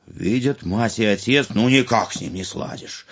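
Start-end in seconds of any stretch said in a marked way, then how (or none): none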